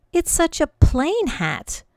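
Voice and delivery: high, slow let-down whine; girl's dismayed lament; disappointed. Acted. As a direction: sound crushed by the plain hat. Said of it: "she gasped".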